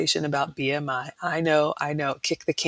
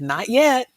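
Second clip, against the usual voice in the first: "yet" swooping up high and loud; emphatic YET; 8 semitones higher, 5 dB louder than usual. Retold or imitated. Quoting Nancy on forbidden foods, emphatic "yet". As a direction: punch "yet" with a big lift in pitch. Quotes Nancy's hopeful qualification.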